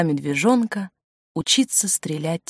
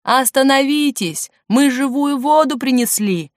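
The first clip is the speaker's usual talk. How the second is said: raised, urgent hollering; shouting; 6 semitones higher, 3 dB louder than usual. A narrator's reading. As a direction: call out across a distance. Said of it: "крикнул Андрюша, увидев в кабине пилота".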